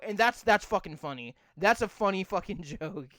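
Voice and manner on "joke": ordinary talk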